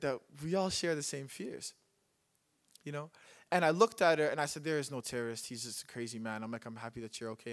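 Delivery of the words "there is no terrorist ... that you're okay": much lower, very soft; hushed, low comforting of someone scared; gentle reassurance. Retold or imitated. Young man comforts the frightened woman, softly reassuring her.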